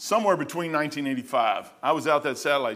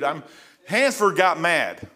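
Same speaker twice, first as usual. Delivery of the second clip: ordinary talk, nothing marked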